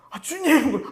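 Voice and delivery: high loud cry of Oh Lord!; calling out loud. Pretend. Believer crying to God as if far, high loud shout. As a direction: cry out to heaven at full voice. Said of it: "소리 지를 때".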